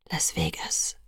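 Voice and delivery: very low, soft murmur; quiet promise. A narrator's reading. Edward reassures Bella of their plan, quietly. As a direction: promise it privately, under the breath. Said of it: "versprach Edward mir leise".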